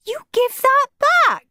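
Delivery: put-on very high squeaky child shout; a small boy yelling for his turn; high shouting little brother. A narrator's reading. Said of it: "shouted Peter".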